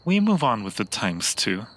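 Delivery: ordinary talk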